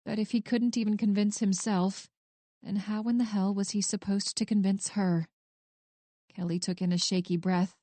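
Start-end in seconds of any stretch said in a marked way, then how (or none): none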